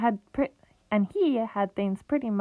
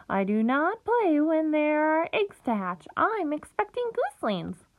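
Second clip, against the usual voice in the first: high, busy goose voice, proud of her eggs; fussy mother goose; 7 semitones higher than usual. Acted. Goose refuses the pig, high and fussy. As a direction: cluck it out like a hen guarding a nest.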